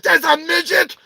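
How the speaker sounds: high, shouted disbelief; hammed-up shock at a twist in the soap; mind-blown viewer. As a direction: yell in amazed disbelief. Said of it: "you're all like".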